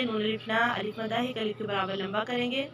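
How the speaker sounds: ordinary talk, nothing marked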